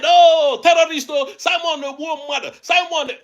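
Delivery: high, shouted mock wail of his critics; mocking imitation. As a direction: parrot the accusers with exaggerated outrage. Mocking critics who call him terrorist.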